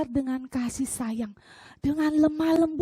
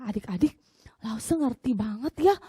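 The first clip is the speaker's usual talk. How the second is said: ordinary talk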